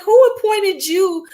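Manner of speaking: high, incredulous challenge; mock outrage. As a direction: scoff at a self-appointed expert. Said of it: "I be like".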